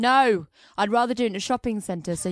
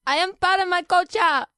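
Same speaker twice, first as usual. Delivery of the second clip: higher, put-on girlish voice; daughter voice; 11 semitones higher, 4 dB louder than usual. Daughter asks her father why the flowers were taken, in a higher girlish voice. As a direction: play a bewildered young daughter.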